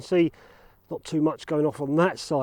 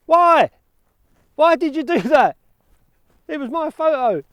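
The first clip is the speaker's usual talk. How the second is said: high, loud, hammed-up cry of protest; mock outrage; 9 semitones higher, 6 dB louder than usual. Vlogger mock-protesting at the farmer who cut the grass, hammed-up outrage. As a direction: wail at the farmer like a wronged child.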